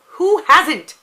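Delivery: as if the question were absurd; playful disbelief; mock incredulous. Real, not acted. Answers an obvious question.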